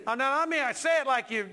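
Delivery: normal talking voice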